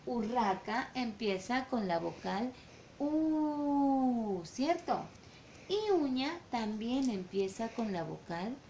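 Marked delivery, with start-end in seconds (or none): 3.0-4.4 exaggerated rounded "U", lips pushed forward; drawn-out U. Shown as a demonstration. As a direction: show the mouth shape on "U". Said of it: "Mire mi boquita". ("U" exagerada con labios redondeados)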